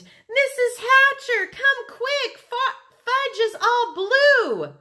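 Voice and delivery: put-on high, loud child calling for help; girl's alarmed scream. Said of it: "when Sheila screamed".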